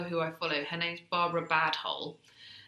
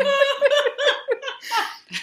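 real laugh, high and loud; can't stop laughing; high shrieking laugh; 11 dB louder than usual. Real, not acted. Laughing after reading email.

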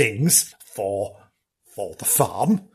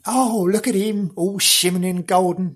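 higher, dreamy cooing; pitched-up mock admiration; admiring onlooker; 5 semitones higher than usual. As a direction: coo it like a smitten onlooker. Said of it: "Sitting there going".

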